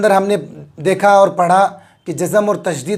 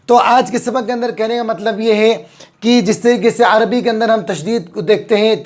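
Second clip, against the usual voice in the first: ordinary delivery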